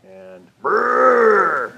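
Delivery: loud buzzing brrr; engine brrr. Shown as a demonstration. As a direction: rumble like a motor. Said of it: "What else do we have".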